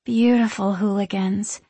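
lovestruck, lingering agreement; dreamy. A narrator's reading. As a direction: sigh it like a smitten girl. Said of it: "Sarah agrees dreamily".

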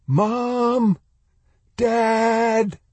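high, sustained call, held long; shouting. A narrator's reading. Boy calling for his parents in the rubble, shouting. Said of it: "he shouted".

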